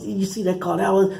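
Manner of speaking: high-pitched, mock-excited manager voice; imitating excited manager. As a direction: squeak it out like the worked-up manager on the phone. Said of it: "and he goes".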